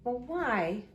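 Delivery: high, rising baffled question; exaggerated wondering, voice climbing; mock puzzlement. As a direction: play puzzled for the children. Teacher acts puzzled at carrot picture.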